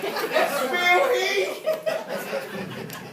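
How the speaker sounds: high, loud fangirl squeal; starstruck young girl. Acted. Gym-goer spots his bodybuilding idol, starstruck girlish squeal. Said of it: "like [name] and I'm nine-year-old girl".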